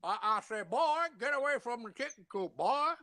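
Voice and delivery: high southern rooster bluster; Foghorn Leghorn. Pretend. Asked by friends, a high Foghorn Leghorn impression. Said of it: "Listen to [name] do it".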